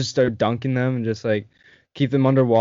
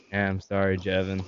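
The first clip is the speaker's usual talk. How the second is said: lower, softer sheepish sorry; meek sheepish apology; 5 semitones lower, 6 dB quieter than usual. Retold or imitated. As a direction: mumble it like guilty friends. Friends apologising meekly, retold.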